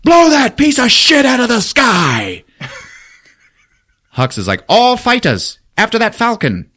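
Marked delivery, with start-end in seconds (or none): 0.0-2.4 loud, high, frantic shouted order; Kylo's furious yell. Retold or imitated. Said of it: "he fucking yells out". 4.7-6.7 high, loud mock commander; Hux giving orders. Hux barking orders to fighters.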